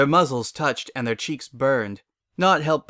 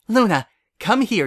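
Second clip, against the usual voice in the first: excited call; 10 semitones higher, 6 dB louder than usual. Acted. Excited sister pulling Luna over.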